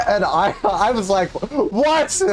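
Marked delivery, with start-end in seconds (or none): 1.3-2.2 high, stunned "What?"; a sudden high cry of shock; shocked exclamation. Retold or imitated. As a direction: blurt it out in disbelief. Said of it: "I never exclaimed".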